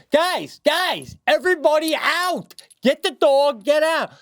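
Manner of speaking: high-pitched retold evacuation yell; panicked urgency. Dad ordering family and dog out of the house, panicked urgency. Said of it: "you're scaring her".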